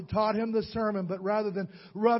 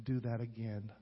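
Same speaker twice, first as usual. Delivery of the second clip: much softer, hushed plea of a crying grandmother; a grandmother begging forgiveness through tears, softly; imitating tearful plea; 9 semitones lower, 9 dB quieter than usual. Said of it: "Tears streaming down her cheeks".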